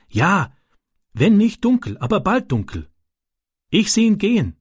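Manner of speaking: höhere, langsame Stimme eines schüchternen ausländischen Jungen; Ram, ausländischer Junge. A narrator's reading. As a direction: ein kleiner Junge, der stockend in gebrochenem Deutsch erzählt. Ram answers Francis. (kindlich hoch und zögernd, Ram erzählt Francis von den Jungen)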